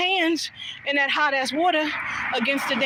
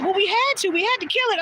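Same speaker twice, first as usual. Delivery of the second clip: matter-of-fact grandma; 8 semitones higher than usual. Retold or imitated. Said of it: "She said".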